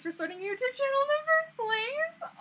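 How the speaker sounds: high, loud squeal of gratitude; squeaky, flattered delight; touched gushing. Real, not acted. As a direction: gush as if overwhelmed by a compliment. Touched by viewer's compliment.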